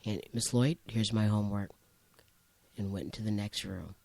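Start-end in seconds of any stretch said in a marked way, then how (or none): 0.3-1.7 soft, low, unbothered reply; understated and unruffled; calm student. Retold or imitated. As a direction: say it quietly, as if nothing happened. Unruffled student answers screaming assistant principal.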